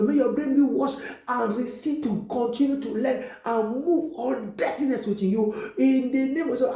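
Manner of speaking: rising revival prayer; fervent prayer. Acted. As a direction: pray it over them with full force. Preaching blessing prayer over viewers.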